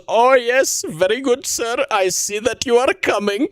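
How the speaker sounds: high, fawning servant voice; servile operator voice. Retold or imitated. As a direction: play a groveling high-voiced helper. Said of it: "as he goes".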